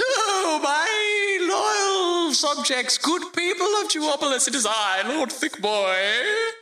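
high, slow, pompous lord's proclamation; warm regal boom. Acted. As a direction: announce yourself grandly to your subjects. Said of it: "a rich melodious voice rings".

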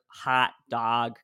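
drawn-out Chicago "hot dog"; "hot dog" in a Chicago accent, slow, fronted vowel. Retold or imitated. Host retelling voice class, hard Chicago accent on "hot dog". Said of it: "must be from Chicago".

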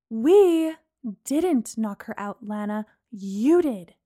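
pointed, stressing "You did"; pointedly. A narrator's reading. Friend correcting Lana, pointed stress on "You". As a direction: lay the blame on her.

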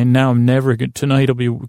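ordinary talk

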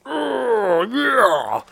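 high, squeaky excited oh yeah; eager oh yeah. Retold or imitated. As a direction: gush with excitement. Imagined eager reaction.

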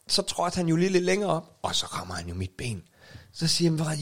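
ordinary talk

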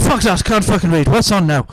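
loud mock angry customer; dad shouting at the ticket seller; angry yell. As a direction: yell it, furious you can't read.

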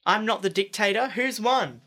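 loud, lifted host voice; game show host. Acted. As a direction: announce it to the room. Host announcing the winner.

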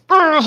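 sudden strained argh; a short pained groan; pained grunt. Retold or imitated. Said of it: "like, it goes".

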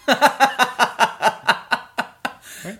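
high, loud burst of laughter; can't hold the laugh in. Real, not acted. Laughs at the absurd treatment.

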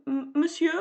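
a hint of a startled butler, stammered; lightly voiced butler stumbling on the word; hesitant butler. A narrator's reading. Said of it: "demande le majordome".

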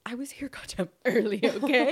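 mock-angry retort breaking into laughter; laughing indignation. Client retells snapping back about being early, indignant through laughter. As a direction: build to a loud, laughing "okay?".

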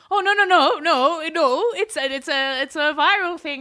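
loud, high flustered denial; panicked backpedalling. Retold or imitated. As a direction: scramble to take it back. Marketers panicking at police post.

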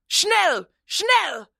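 high-pitched German hurry-up call; urgent command. Acted. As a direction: hurry the men along. Ordering the jeep readied immediately.

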